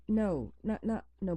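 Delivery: ordinary talk, nothing marked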